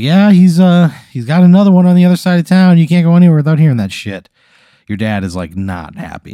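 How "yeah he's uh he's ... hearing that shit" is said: louder, higher voice of a fed-up parent; griping parent. Acted. Parents moan about the ice cream man, loud griping voice. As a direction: complain loudly as an irritated parent. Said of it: "your folks are like".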